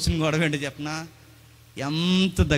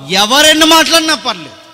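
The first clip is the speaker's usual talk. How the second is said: higher, louder plea to the Lord; 8 semitones higher, 10 dB louder than usual. Retold or imitated. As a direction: beg the Lord out loud. David pleading to God.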